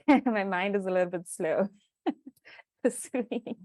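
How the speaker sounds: chuckling through the words; laughing. Real, not acted. Panelist laughing about her tired mind after trekking. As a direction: laugh it out, a bit sheepish.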